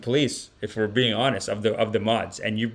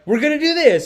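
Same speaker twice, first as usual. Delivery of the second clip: mock eager planner; 13 semitones higher, 4 dB louder than usual. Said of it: "someone's like".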